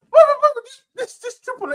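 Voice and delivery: stacked frantic bros. Real, not acted. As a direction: burst out in disbelief. Exploding in disbelief.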